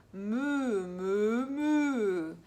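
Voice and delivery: loud, drawn-out mooing; cow moo. Shown as a demonstration. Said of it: "I'll try and do a, a moo noise".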